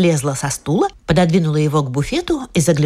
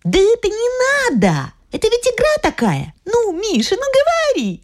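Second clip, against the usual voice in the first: put-on little girl voice; 13 semitones higher than usual. Little girl insisting on game.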